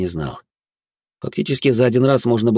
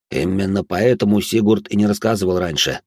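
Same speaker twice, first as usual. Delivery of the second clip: usual speaking voice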